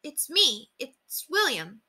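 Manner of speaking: soft, high, slow answer; small careful voice, slowing down; gentle reassurance. A narrator's reading. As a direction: softly let her know who it is. Reassuring the startled tiny woman.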